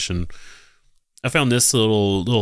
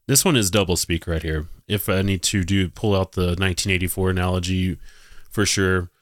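ordinary talk, nothing marked

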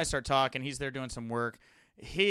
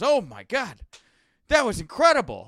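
amazed exclamation; 7 semitones higher, 11 dB louder than usual. Retold or imitated. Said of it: "and he goes".

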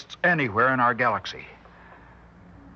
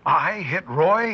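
stunned disbelief; 6 semitones higher, much slower than usual. Acted. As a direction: drag it out in horror. Learns he hit Roy.

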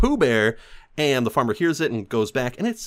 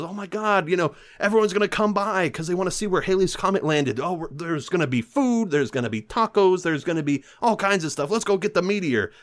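higher, hammed-up country farmer chattering to his dog; excited farmer; 7 semitones higher than usual. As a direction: gush like a giddy old farmer. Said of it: "he says to his little bloodhound".

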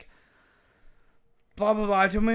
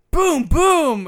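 put-on kid's gunshot noises; 9 semitones higher, 10 dB louder than usual. Son imitating gunshots.

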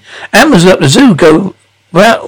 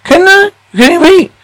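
sarcastic retort; 11 semitones higher than usual. Acted.

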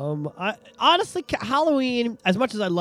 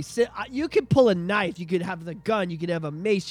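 ordinary talk, nothing marked

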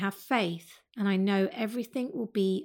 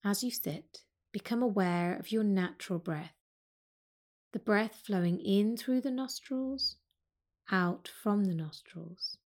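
calm yoga teacher guiding a breathing practice; guided meditation. Acted. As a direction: ease them into the breath, unhurried. Leads a seated breath meditation.

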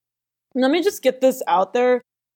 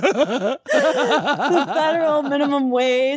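laughing; 5 semitones higher, slower than usual. Real, not acted. Laughing, slowed, pitch up.

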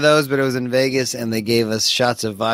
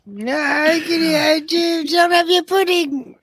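high, slow mock British teacher; British schoolteacher; slower than usual. Co-host quoting Another Brick in the Wall, high teacher voice. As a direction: do a piping caricature of a scolding schoolmaster. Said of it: "Another Brick in the Wall at the end".

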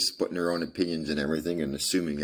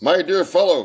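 high, loud eager greeting; an eager cry to a friend; excited cry; 12 semitones higher, 10 dB louder than usual. A narrator's reading. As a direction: burst out with delight at a friend. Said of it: "he cried".

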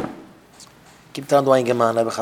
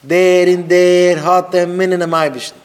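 loud, slow, sustained proclamation; herald's cry, higher and drawn out; calling out announcement; 4 semitones higher, 6 dB louder, much slower than usual. Acted. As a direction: proclaim it to the whole crowd. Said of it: "rief man aus".

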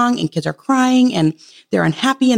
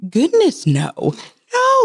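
much higher, firm no-no; emphatic refusal; 8 semitones higher, slower than usual. Retold or imitated. Mom refusing to try four hours outside, shocked high no. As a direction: turn the idea down flat, pitched way up. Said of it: "And I was thinking".

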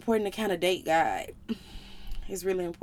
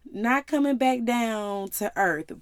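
God's voice; 4 semitones higher, 9 dB louder, slower than usual. Retold or imitated. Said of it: "But he was like".

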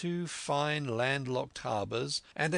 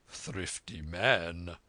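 lower, slow wry comment; dry doctor's remark; 5 semitones lower, much slower than usual. A narrator's reading. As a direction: savour the irony like the doctor. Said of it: "cried the doctor".

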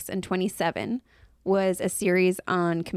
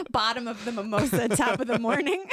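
giggling through the punchline; laughing; 3 semitones higher than usual. Real, not acted. Daughter joking about too many mimosas, laughing as she speaks. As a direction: let the words dissolve into laughter.

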